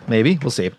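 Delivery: normal talking voice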